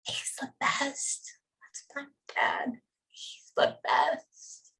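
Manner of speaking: slow, tearful whisper; choked-up whisper. Real, not acted. Medium relaying a child's words about his dad, choked-up whisper. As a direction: whisper it slowly, overcome. Overcome, whispering after nearly crying.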